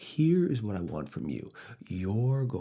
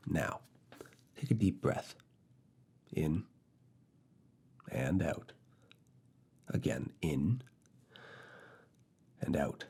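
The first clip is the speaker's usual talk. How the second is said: soothing breath guide. Acted.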